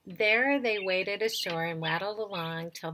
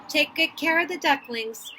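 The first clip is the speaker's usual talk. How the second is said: higher storybook call; raised voice for a parting instruction; calling back over shoulder; 6 semitones higher, 4 dB louder than usual. A narrator's reading. Father duck leaving his mate with the ducklings, calling back. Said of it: "he quacked over his shoulder".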